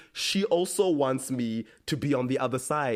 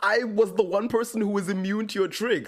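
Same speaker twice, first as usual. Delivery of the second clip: shocked disbelief; 9 semitones higher than usual. Retold or imitated. Bonnie shocked in retold scene.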